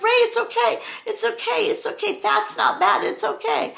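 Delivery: high, loud soothing to calm him; calm a panicking kid over his screams; reassuring. Retold or imitated. Parents calming screaming child.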